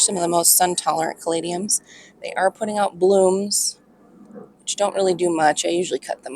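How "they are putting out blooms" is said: ordinary delivery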